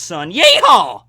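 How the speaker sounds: loud, high hammed-up Texas yeehaw; cowboy yeehaw. Vlogger whooping a mock Texas cowboy yeehaw. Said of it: "kind of a cowboy accent".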